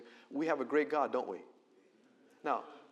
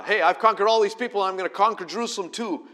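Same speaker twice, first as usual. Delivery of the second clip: loud, fast gloating king; boastful conqueror; 6 semitones higher, 10 dB louder, faster than usual. Retold or imitated. Sennacherib taunting Jerusalem through his general, loud boast. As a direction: crow over every nation he crushed.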